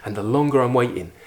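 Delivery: ordinary delivery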